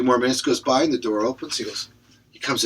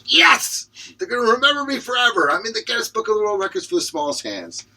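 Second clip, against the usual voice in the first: much higher, louder victory cry; triumphant cheer; 10 semitones higher, 4 dB louder than usual. Acted. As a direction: celebrate the record. Character triumphant after record.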